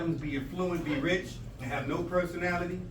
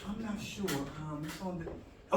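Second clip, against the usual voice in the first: thinking aloud; 6 dB quieter than usual. Acted. Acting someone searching memory.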